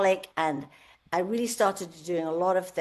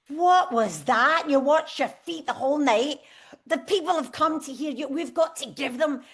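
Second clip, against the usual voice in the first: mild retold scolding; 6 semitones higher than usual. Singer ticking off her guitarist after the show, mild scolding. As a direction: pitch it up, taking someone to task.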